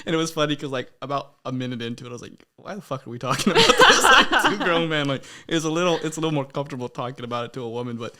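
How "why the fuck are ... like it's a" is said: laughing. Retold or imitated. Retold outburst, laughing.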